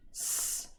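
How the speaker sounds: a soft hissed s alone; isolated s sound. Shown as a demonstration. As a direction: say just the first sound s. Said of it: "isolate the beginning sound".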